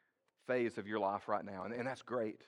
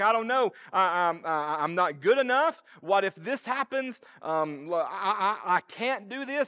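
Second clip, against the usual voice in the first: anxious self-doubt; 8 semitones higher, 10 dB louder, slower than usual. Retold or imitated. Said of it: "as we face those moments that we're like".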